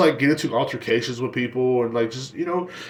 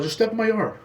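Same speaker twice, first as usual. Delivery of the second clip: challenging yell; 9 semitones higher than usual. Retold or imitated. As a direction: dare him to step onto your lawn. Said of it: "started yelling at my stepdad".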